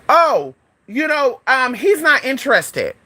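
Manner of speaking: high, slow mock-polite brush-off; dismissive auntie. Auntie turning away her nephew's admirer, high slow brush-off. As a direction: imitate an auntie stalling as she warns him off. Auntie fends off guy, retold.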